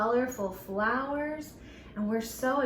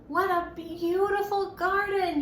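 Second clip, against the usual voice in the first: ordinary delivery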